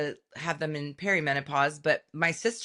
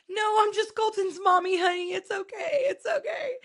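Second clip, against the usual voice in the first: much higher, sing-song reassuring mom voice; soothing mommy. Acted. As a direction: coo at the frightened child to calm her. Calming a scared little girl.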